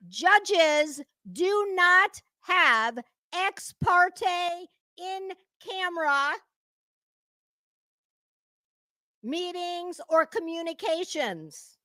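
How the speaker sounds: put-on very slow, each word laid out as if to a child; slow condescending lecture. Explaining law to Betty, very slow and condescending. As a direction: spell it out for the idiot.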